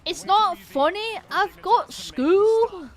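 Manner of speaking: high, loud, long-drawn mock British accent; put-on regional British accent. Streamer mimicking local accents for chat, high loud mock British accent.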